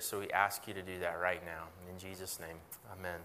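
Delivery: soft, unhurried prayer to God; quiet prayer. Acted. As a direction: pray gently over the room. Pastor leads prayer.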